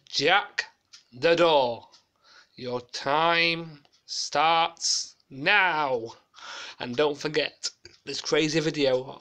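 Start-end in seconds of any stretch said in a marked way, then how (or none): 0.1-6.1 loud, very slow drawn-out host catchphrase; hammed-up countdown signal; Leslie Grantham catchphrase. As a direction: drag out the start call like a TV host. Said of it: "doing a copycat of Leslie Grantham on Fort Bayard".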